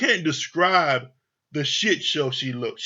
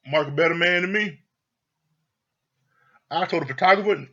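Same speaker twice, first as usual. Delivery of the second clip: ordinary talk, nothing marked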